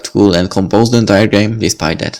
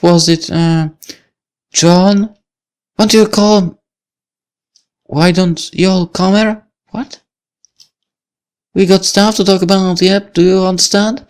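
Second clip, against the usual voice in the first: higher, slow, put-on hippie drawl; mock a laid-back hippie; odd hippie accent; 11 semitones higher, slower than usual. Lucy mimicking how she thinks he talks, hippie accent. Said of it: "in some odd hippie accent".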